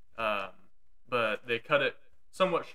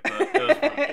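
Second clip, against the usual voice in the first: excited; 10 dB louder than usual. Real, not acted. Guest's excited sign-off.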